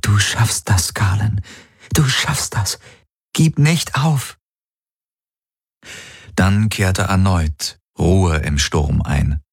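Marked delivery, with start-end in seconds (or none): 0.0-4.4 pleading. A narrator's reading. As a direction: plead with him to hold on. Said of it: "Kirai hielt flehend die Hände zusammen".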